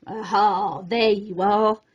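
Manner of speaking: lightly voiced young man calling out; called out. A narrator's reading. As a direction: sing out like you've found him. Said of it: "a young man called out".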